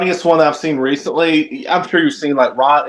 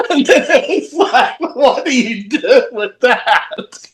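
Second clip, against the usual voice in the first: laughing through the words; 6 semitones higher, slower than usual. Real, not acted. Laughing while retelling prank call.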